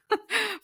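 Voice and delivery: a quick high giggle at herself; brief amused laugh; short high laugh. Real, not acted. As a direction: laugh it off lightly. Laugh after her own line.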